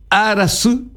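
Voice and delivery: Arasu lifted high with a rise; high announcing word. Shown as a demonstration. Teacher introducing Arasu to the class. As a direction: call out Arasu. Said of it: "teacher introduces new word".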